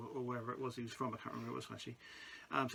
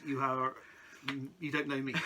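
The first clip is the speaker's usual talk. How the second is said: usual speaking voice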